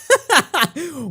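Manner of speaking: high amused giggle. Real, not acted. Amused by fans' speech, a high giggle. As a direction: giggle once.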